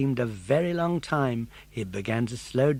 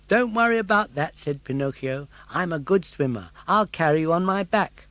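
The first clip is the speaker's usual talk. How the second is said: put-on high eager puppet boy; bright young puppet voice; Pinocchio voice; 5 semitones higher, 6 dB louder than usual. Pinocchio's lines.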